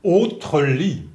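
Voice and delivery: usual speaking voice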